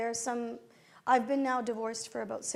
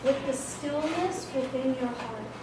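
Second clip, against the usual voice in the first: guided meditation; 3 dB louder than usual. Acted. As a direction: guide the room into stillness. Leads a meditation.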